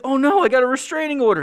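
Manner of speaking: high, loud wail of dismay; mock dismay. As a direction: cry out in hammed-up despair. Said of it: "And he's like".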